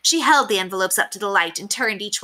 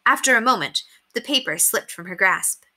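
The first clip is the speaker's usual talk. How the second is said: usual speaking voice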